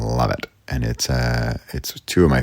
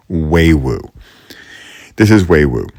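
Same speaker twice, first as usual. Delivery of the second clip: "way woo" called out much louder; playful shout; 4 semitones higher, 9 dB louder than usual. Real, not acted. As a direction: belt out "way woo" for fun.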